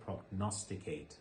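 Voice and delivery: prognosticate slow, stress on the syllable nos. Shown as a demonstration. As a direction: stretch prognosticate out, lean on the N. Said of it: "استرسش روی N میفته".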